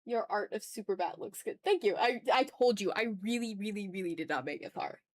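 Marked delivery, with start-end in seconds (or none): none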